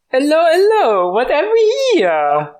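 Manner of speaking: high, put-on French accent; French-accented policeman, drawn out at the end. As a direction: greet them in a hammy, high French accent. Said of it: "Why do you have a French accent?".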